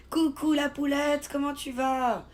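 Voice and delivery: high, singsong hello; excited greeting. Real, not acted. Streamer says hello to a friend in chat, excited.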